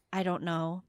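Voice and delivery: low-energy, soft non-answer; mock noncommittal shrug; evasive mumble. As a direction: imitate a softly evasive reply. Questioned friend gives evasive answer.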